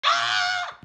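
loud high scream of alarm; startled scream. Acted. Kid hears a big boom, loud startled scream.

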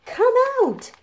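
high, loud call. Acted. Reading the story, calling the dinosaurs out loud. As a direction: call them out of hiding.

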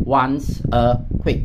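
slow, careful "once a week"; slow model reading. Shown as a demonstration. Teacher modeling the phrase slowly for students. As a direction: read it slowly for learners. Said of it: "model reading".

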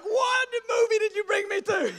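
loud, high outraged question; the dad, appalled; father shouts at his son in shock. Retold or imitated.

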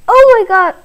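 high, loud gasp of disbelief; shocked cry. Real, not acted. Gamer stunned by what happened, shocked cry. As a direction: blurt it out stunned.

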